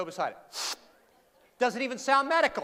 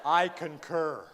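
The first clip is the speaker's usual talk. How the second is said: slow, lower, mock-solemn "I concur"; pompous doctor; 5 semitones lower, much slower than usual. Agreeing with the doctor, slow mock-solemn doctor voice. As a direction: play a grave doctor drawing it out.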